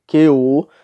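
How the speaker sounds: "que o" run together as one loud syllable; vogais agregadas. Shown as a demonstration. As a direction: join "que o" without a break. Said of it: "nós lemos assim". ("que o" emendado numa sílaba só)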